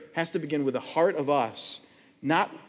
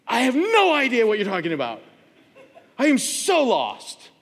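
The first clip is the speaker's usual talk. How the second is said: bewildered; 5 semitones higher, 4 dB louder than usual. Retold or imitated. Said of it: "and I'm like".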